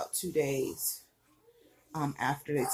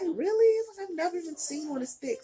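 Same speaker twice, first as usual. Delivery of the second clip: very high-pitched mock disbelief; astonished; 11 semitones higher than usual. Other doctor hears who did it, mock astonished. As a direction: imitate an amazed doctor, voice shooting up.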